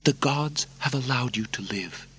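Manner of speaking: high, hushed, intense voice; urgent princess. Acted. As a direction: persuade him in secret. Narrator voices Lucilla.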